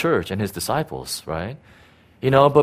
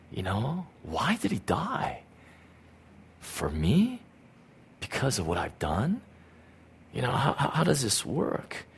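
hushed wonder; 10 dB quieter than usual. Retold or imitated. Hushed inner questions.

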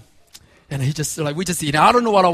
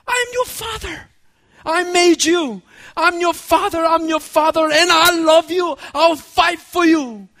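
raised, proclaiming fatherly voice; voice of God; 10 semitones higher, 4 dB louder than usual. Acted. God speaking to his children, raised and proclaiming. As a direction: declare it like a loving father. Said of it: "he said".

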